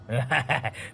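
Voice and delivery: gloating chuckle; sated chuckle. A narrator's reading. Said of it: "Bình lè nhè nói".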